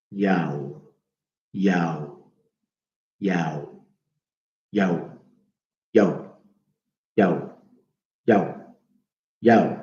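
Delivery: Yao drawn out, repeated slowly for the listener; sounding out Yao. Shown as a demonstration. Teacher sounding out Yao for students. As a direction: say Yao over and over so they hear it. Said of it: "So listen".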